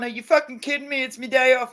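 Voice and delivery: loud high mock rant; angry neighbour. Neighbour yelling on his day off, hammed-up loud outrage. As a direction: imitate him exploding with outrage. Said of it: "He's going".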